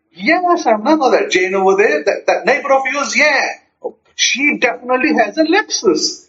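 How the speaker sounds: high-pitched, animated back-and-forth; excited. Acted. Two friends discussing the neighbor's car, excited. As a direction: act out thrilled gossip about a neighbor. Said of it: "how the mood changes".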